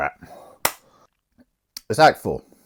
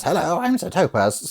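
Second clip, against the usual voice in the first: high, silly put-on priest voice; hammed-up impostor greeting; Sir Topaz; 8 semitones higher than usual. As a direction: greet in a squeaky fake-priest voice. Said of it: "And then Sir Topaz".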